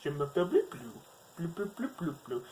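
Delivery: high hammy fake French gibberish; foreign accent in French. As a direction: mangle French like Joey Tribbiani. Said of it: "Joey Tribbiani trying to speak French".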